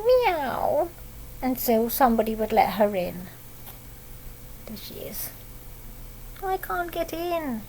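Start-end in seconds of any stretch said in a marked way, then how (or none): 0.0-0.9 high mock cat meow. Cat asking to come in, high meow. Reading to a child. 4.7-5.3 soft unvoiced whisper. A narrator's reading. Showing a child the cat outside, whisper. Pointing at a picture. 6.4-7.6 high, soft little cat voice; cat's plea. Pretend. Cat locked out, small high plea.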